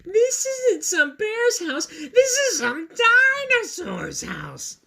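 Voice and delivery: put-on high, slow, loud panicked girl; slow high squeal of alarm; shocked and scared. A narrator's reading. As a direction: near tears with a crying edge, realising the danger. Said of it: "This is her shocked and surprised".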